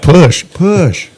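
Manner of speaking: high, loud mock cheering; excited. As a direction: egg it on, hammed up. Joking about pushing the puppy.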